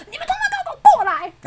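very high, mock-furious call; retold summoning yell. Girlfriend summons boyfriend's friends, retold high-pitched yell. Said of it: "我就叫他们朋友过来".

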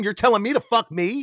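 high-pitched incredulous protest; mock indignation. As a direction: protest the insult in comic disbelief. Protests in comic quarrel.

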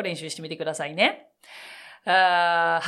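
normal talking voice

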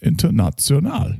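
"International" as internatsionahl, German ts; German pronunciation. Shown as a demonstration. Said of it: "That is the way your name is said".

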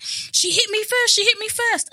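high, slow, whining protest; whiny student voice. Retold or imitated. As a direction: whine it like a tattling kid. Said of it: "the student was like".

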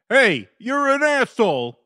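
English boy imitating an American accent. English boy standing up to bullies, in American accent. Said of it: "an American accent".

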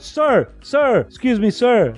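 sharp high call to stop someone; security guard hailing an intruder. Acted. Security guard calling out to a man who wandered in, authoritative. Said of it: "um segurança".

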